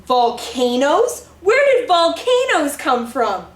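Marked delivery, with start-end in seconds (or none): none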